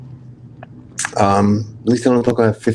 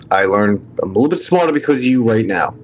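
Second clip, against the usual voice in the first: ordinary talk, nothing marked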